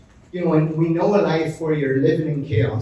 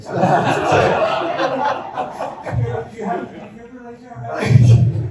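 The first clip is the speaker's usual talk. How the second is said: breathy laugh; 8 semitones higher than usual. Real, not acted. Joking Peter won't die.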